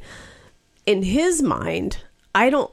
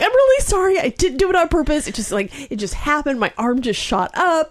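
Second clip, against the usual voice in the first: frantic apology; 7 semitones higher than usual. Retold or imitated. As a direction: plead your innocence breathlessly. Her frantic apology retold.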